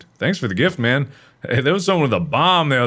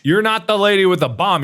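ordinary talk

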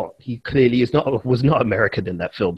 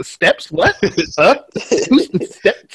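mock bafflement, pitched high; clueless American viewers; 8 semitones higher, slower than usual. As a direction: play a totally lost viewer. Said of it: "American viewers don't have no fucking clue".

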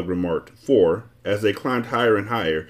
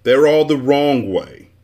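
loud, slow and higher; shouted objection; 5 semitones higher, 6 dB louder, slower than usual. A narrator's reading. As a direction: insist indignantly. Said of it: "he shouted".

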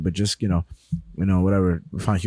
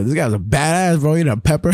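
ordinary talk

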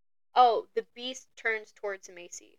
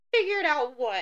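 high, loud, incredulous question; as if the question makes no sense; Grace, cornered by Hudson, asks in loud disbelief; baffled Grace; 6 semitones higher, 7 dB louder than usual. Retold or imitated. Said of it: "And Grace is like".

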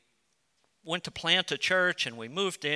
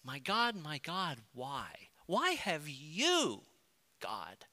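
aching plea with long-drawn words, pitched up then falling away; anguished cry to God; 3 dB quieter, slower than usual. Acted. Approaching God with an aching heart, anguished. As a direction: cry out a slow, wounded question in prayer. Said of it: "the ache and the agony of your heart".

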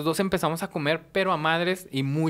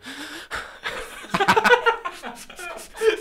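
long, high-pitched helpless laugh; wheezy laugh that won't stop; uncontrollable laughing fit; 13 semitones higher than usual. Shown as a demonstration. Showing how uncontrollable it was, helpless laughing fit. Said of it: "Era incontrolable".

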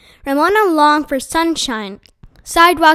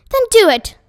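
short, sharp and loud, higher; snapped; 8 semitones higher, 6 dB louder than usual. A narrator's reading. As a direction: bite the words off, fed up. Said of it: "snapped Missus Quimby".

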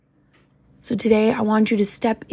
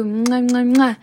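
between kisses; 3 semitones higher, 4 dB louder, slower than usual. Real, not acted. Blowing kisses goodbye to viewers.

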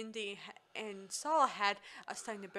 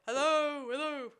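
hammed-up villain calling down; villain's mocking hello; 5 semitones higher, 7 dB louder than usual. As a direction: taunt down into the pit. Belloc voiced calling down.

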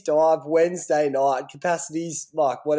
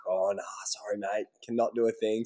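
low, quiet manager voice; 9 semitones lower, 10 dB quieter than usual. Retold or imitated. Manager Glenn's reply retold.

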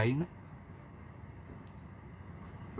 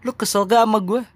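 higher, louder pointed question, "gak" stretched; asking loudly; 7 semitones higher, 8 dB louder than usual. Pretend. Host asks the listener if they're upset, raised and loud. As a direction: demand it out loud, pitch up high. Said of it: "Biarkan gua yang nanya ke lu".

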